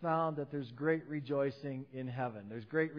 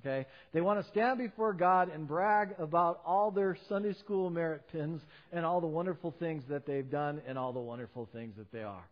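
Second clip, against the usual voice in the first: ordinary talk, nothing marked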